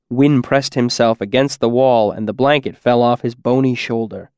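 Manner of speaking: plain reading voice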